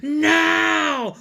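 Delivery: high, loud monster yell; high dinosaur battle cry. A narrator's reading. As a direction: bellow it as a charging dinosaur. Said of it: "a trio of dinosaurs yelling".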